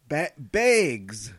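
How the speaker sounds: Minnesota "bags" with a short e-vowel, mocking; Minnesota accent. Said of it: "You're making fun of my Minnesota accent".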